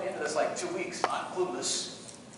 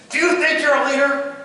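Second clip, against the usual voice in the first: raised, higher instructor voice; stern challenge; 7 semitones higher, 5 dB louder than usual. Retold or imitated. Drill instructor looking cadet straight in eye, loud challenge.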